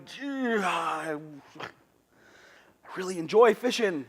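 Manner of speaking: laughing mock-innocent line in a put-on voice. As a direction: play coy, chuckling through it. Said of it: "we're going".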